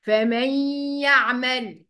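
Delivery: "فمن يعمل" as fa-mayyy-ya'mal; nasal ghunnah. Shown as a demonstration. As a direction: hum the doubled y of "فمن يعمل" through the nose. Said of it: "هي دي الغنة".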